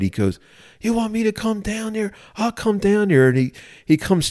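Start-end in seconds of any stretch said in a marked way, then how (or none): 0.8-3.3 high-pitched mock-angry comeback; mad retort, imitated. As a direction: imitate an indignant man taking up a challenge. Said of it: "he got mad".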